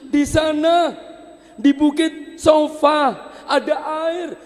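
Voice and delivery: high, loud call; loud, high and hopeful; excited discovery. Acted. Mother sees water on the hill, high and loud.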